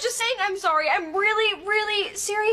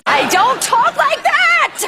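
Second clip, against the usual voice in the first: loud, high, slow protest; indignant protest; 6 semitones higher, 9 dB louder, much slower than usual. Acted. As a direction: shout it back, stretching each word. Protesting a mocking impression of her.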